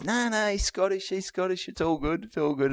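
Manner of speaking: soft, high-pitched mock assurance; imitated hushed, placating aside; hushed reassurance. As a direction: hush them quickly in a small high voice. Retold reassurance about the Scottish act.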